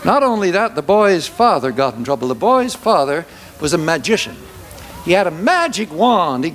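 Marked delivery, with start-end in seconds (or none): none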